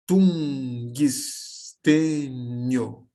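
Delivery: Tungstênio very slow as tun-gis-tê-nio; slow demo of Tungstênio with the extra i; spoken syllables with added i. Shown as a demonstration. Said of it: "Como ficaria na fala? Levando em conta o impulso de voz".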